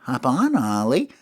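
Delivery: high taunting critter voice; sing-song it up high; Smalls' voice. Acted. Smalls taunting Ollie to get back on. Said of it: "Smalls gets off and he goes".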